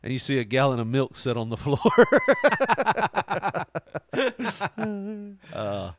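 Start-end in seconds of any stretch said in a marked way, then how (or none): 1.8-5.5 high, long rattling laugh. Real, not acted. Podcaster cracking up at the milk story, long high laugh. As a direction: laugh hard in a long run.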